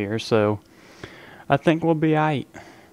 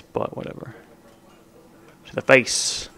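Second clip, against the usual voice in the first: breathy under-the-breath whatever; whispered shrug. Real, not acted. Gamer muttering to himself mid-match, whispered. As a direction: whisper it to yourself, dismissive.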